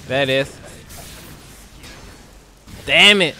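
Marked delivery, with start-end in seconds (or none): 2.8-3.3 frustrated shout. Real, not acted. Losing a close round.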